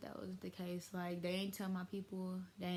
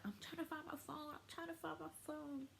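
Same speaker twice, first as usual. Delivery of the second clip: high, soft little put-on voice; mock innocent excuse; 9 semitones higher, 8 dB quieter than usual. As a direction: act out a feeble excuse, high and small. Acts out her excuse when caught.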